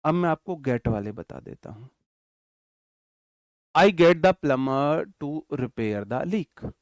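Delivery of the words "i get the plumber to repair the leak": very slow, loud, word by word; slow dictation. Shown as a demonstration. Teacher dictating an example sentence to his class, very slow and loud. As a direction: dictate each word so students can write it. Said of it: "teacher dictates example sentence".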